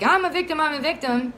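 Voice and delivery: high, whiny mocking voice; mocking whine. Mocking a parent who plays the victim, high whiny mock voice. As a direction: sneer it out like a fake victim. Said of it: "someone might be like".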